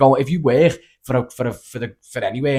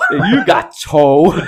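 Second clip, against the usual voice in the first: very slow, louder, laughing through it; 5 semitones higher, 7 dB louder, much slower than usual. Real, not acted. Laughing repeat of quote.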